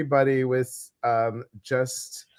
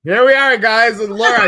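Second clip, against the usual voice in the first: excited announcing; 10 semitones higher, 11 dB louder than usual. Real, not acted. Host announcing co-host.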